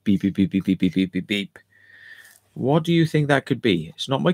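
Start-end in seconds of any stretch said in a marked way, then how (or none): 0.0-1.6 rapid monotone machine bleeping by mouth; imitated alarm beeps. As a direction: mimic a repeating warning tone. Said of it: "just went beep".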